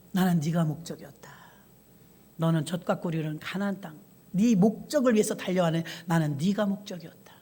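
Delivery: soft, low and gentle; a quiet, loving answer; tender voice of God. Acted. God gently telling a tearful Moses he was the goal.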